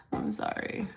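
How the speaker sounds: sorry through a breathy laugh; laughing apology. Real, not acted. Vlogger laughing an apology to viewers after her rant. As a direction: laugh it off softly.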